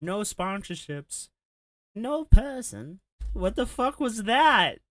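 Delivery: high, loud, slow parody of his voice; co-host parodies the accent back at him; mocking imitation. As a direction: ham up his silly accent. Co-host mimics the accent, incredulous.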